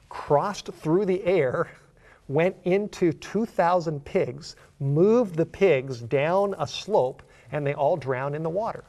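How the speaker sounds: slow, high, loud drawn-out preaching; emphatic preaching. Real, not acted. Preacher arguing from the pigs' drowning, emphatic preaching. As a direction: hammer each phrase out slowly, high and loud.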